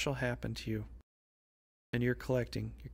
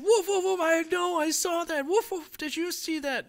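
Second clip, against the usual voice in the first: very high, loud, frantic yapping; barking dog; 7 dB louder than usual. Acted. Dogs telling each other what they saw, frantic high barking. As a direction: bark wildly, talking like an overexcited dog. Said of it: "And the other dog's going".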